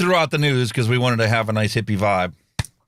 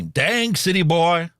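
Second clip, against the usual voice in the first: Southern drawl; 6 semitones higher, slower than usual. Retold or imitated. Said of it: "D C B".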